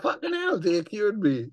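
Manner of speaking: very high-pitched mock astonishment; amazed retelling. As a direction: act out disbelief at a miracle cure. Retold amazement at recovered voice.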